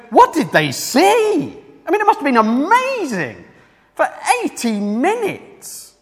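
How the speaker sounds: high-pitched astonishment; amazed wonder. Acted. Preacher voicing listeners wondering about the ovation, amazed.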